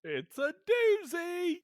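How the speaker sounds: very high, slow, loud gleeful shout; Ned's catchphrase. Retold or imitated. Co-host shouting Ned's puddle catchphrase, high and gleeful. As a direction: belt it out like a goofy salesman.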